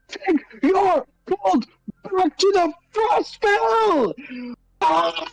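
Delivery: very high, slow battle scream; screaming at water. Acted. Casting firebolts at the ocean, furious high scream. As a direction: shriek it out, every word drawn out. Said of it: "I'm just screaming out the water".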